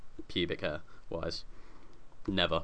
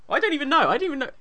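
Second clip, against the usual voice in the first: high, loud exasperated cry; 7 dB louder, faster than usual. Real, not acted. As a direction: throw up your hands, pitched way up. Exasperated repeated high exclamation.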